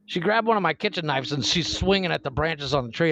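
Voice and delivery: usual speaking voice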